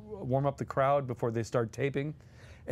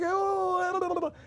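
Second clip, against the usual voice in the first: much higher, far-off mock cry; delighted exclamation. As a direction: shriek with joy.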